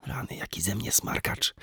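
whispered, embarrassed aside; man whispering how childish he is; whispered self-reproach. A narrator's reading. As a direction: whisper it at yourself. Narrator scolding himself inwardly.

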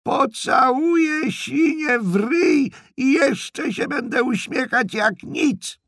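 very high, drawn-out screech; old aunt yelling at full voice; shouting. Acted. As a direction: screech it out loud and slowly. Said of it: "krzyknęła".

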